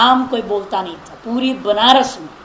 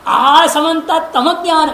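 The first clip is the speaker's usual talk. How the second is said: laughing; 5 semitones higher than usual. Real, not acted. Joking breakdown of a word.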